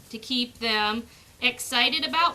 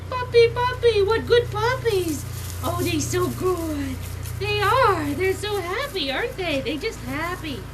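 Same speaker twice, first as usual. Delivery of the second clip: put-on puppy baby talk; 5 semitones higher, 3 dB louder than usual. Shown as a demonstration. Breeder demonstrates her happy voice to the puppies, high and gushing. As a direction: squeal praise like greeting a puppy. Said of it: "you can always use your voice".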